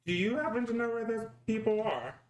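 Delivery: high, polite character voice; high sweet voice. Acted. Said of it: "so it's like".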